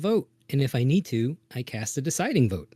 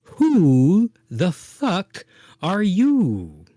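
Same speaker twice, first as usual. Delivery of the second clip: very slow, louder, higher-pitched demand; drawn-out challenge; 4 semitones higher, 5 dB louder, much slower than usual. Pretend. Company member confronting the newcomer Khan, drawn-out challenge. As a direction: stretch every word out as an in-character challenge.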